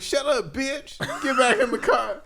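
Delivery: high-pitched retold outburst, half laughing; mock angry retort. Retold angry snap at lady.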